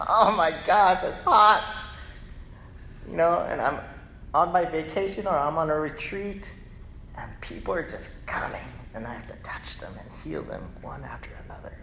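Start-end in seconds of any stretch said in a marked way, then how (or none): 0.1-1.6 chuckling mock whine about heat; half-laughing grumble; laughing complaint. 7.2-11.7 unvoiced quick whisper; tired hushed whisper; whispered aside. Real, not acted. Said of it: "whispered aside about tiring work".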